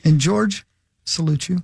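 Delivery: tongue-in-cheek ceremonial address; mock solemn tribute. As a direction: deliver a grave patriotic salute, phrase by phrase. Mock patriotic tribute speech.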